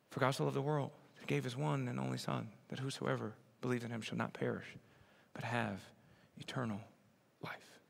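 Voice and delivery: soft, hushed reading that fades to near silence on "life"; hushed scripture read that dies away at the end; reverent scripture reading. Acted. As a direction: read it gently, like something precious. Reads the verse softly.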